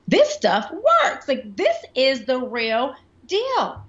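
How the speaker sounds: high, drawn-out emphatic excitement; amazed realisation. Retold or imitated. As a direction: stretch each word out in loud, high amazement. Recalls realising that it works.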